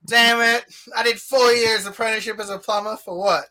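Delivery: hammed-up complaint of an overworked hero; mock Mario. Friend to friends voicing Mario, fed up and hammed-up.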